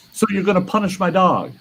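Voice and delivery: incredulous neighbour. Retold or imitated. The neighbour asking about his dog, baffled. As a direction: play it baffled. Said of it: "And he goes".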